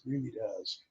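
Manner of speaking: ordinary talk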